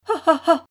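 high, loud burst of laughter; laugh, flattered and amused; delighted laugh. Acted. Rose Air, flattered by the compliment, bright laughing burst.

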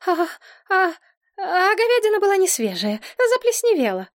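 high, stammering embarrassment; flustered babble. A narrator's reading. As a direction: stumble into an excuse in a high voice. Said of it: "покраснела до свекольного состояния и пролепетала". (смущённо лепеча, высоким голосом)

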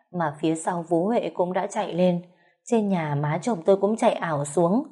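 plain reading voice

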